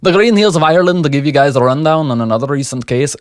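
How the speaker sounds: an Irish brogue drifting into Scottish; Irish accent slipping Scottish. Acted. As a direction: aim for Irish, land half in Scotland. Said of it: "I'm getting a little Scottish".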